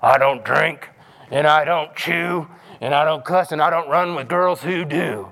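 lightly voiced self-righteous hypocrite; angry hypocrite. Pastor imitating a hypocrite boasting of clean living. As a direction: recite it like a smug rule-keeper. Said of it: "the angry hypocrite".

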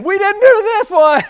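a hammed-up cry of innocence while running; panicked pig farmer. Pig farmers running to town deny blame, hammed-up panicked protest.

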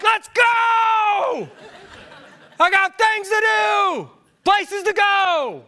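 high, drawn-out exasperated yell; inward impatient shout. Acted. Driver inwardly raging at the stopped car, impatient shouting. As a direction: holler it, stretching the last word. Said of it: "Inwardly, I'm like".

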